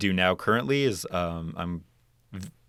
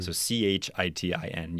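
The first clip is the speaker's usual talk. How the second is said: usual speaking voice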